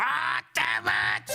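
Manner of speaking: long, high-pitched curse; frustrated yell. Real, not acted. Coder cursing a failed fix, drawn-out high yell.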